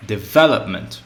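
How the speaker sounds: development, ve hit hard; stress on VE. Shown as a demonstration. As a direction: drill it as de-VE-lop-ment. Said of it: "with the stress or accentuation on the v".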